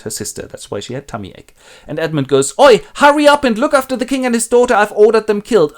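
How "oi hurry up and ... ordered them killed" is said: high, loud urgent call; urgent warning. Acted. Dying Edmund urges them to save the king, loud. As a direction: hurry them along.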